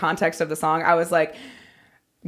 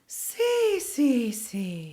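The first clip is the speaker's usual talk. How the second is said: super breathy; 6 dB quieter, much slower than usual. Shown as a demonstration. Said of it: "Make it super breathy".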